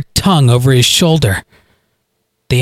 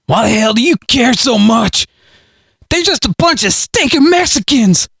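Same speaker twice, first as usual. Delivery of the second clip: pinned hillbilly yells up at Galen, high and indignant; hillbilly shouting; 10 semitones higher than usual. A narrator's reading. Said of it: "Harley shouted".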